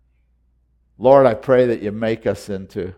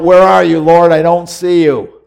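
loud, high cry for the Lord; calling out; 7 semitones higher, 13 dB louder than usual. Pretend. Believer crying out for the Lord, loud and high. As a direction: call out searching for him. Same plea repeated.

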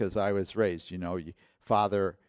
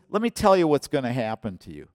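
loud, higher-pitched acted warning; stern warning; 5 semitones higher, 8 dB louder, faster than usual. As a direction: warn him sharply, like a fed-up pastor.

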